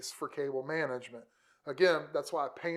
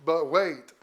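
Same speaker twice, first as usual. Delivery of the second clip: infomercial voice; 5 semitones lower, slower than usual. Acted. Infomercial catchphrase while unboxing gifts.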